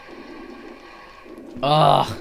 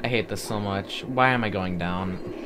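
normal talking voice

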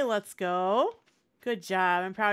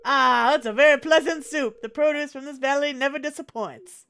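jovial Southern governor, loud and high; 5 semitones higher, 9 dB louder than usual. Acted. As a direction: play a booming Southern politician. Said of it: "I just picture the governor being southern".

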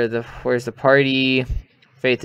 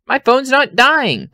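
high, slow and loud disbelief; alarmed; 13 semitones higher, 7 dB louder, slower than usual. Real, not acted. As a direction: cry out at the dying phone. Battery scare in horror game.

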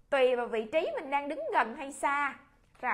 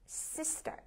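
whisper; 4 semitones higher, 8 dB quieter than usual. Shown as a demonstration. Teacher modelling the word.

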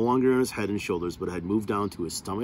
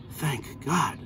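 relieved exclamation; 7 semitones higher than usual. Acted. Relief as lizards hop off.

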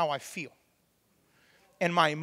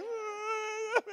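high mock shriek Ah, nearly a cry; 13 semitones higher, 6 dB quieter than usual. Son imitating his fiery mom, high mock shriek. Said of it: "she's like".

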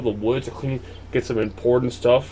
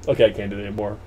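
normal talking voice

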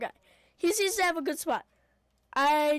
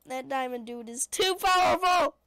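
ordinary talk

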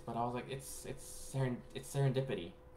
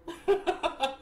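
high bursting giggle, louder; 4 dB louder than usual. Real, not acted. Laughing at her pet on camera, high giggle.

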